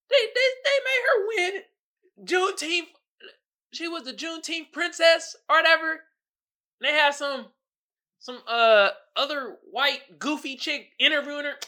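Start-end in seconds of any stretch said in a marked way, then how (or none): 0.1-10.6 incredulous. Real, not acted. Incredulous retelling.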